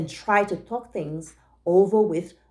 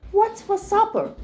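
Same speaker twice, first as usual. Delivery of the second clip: eager lion; 13 semitones higher than usual. Acted. Hungry lion asks eagerly.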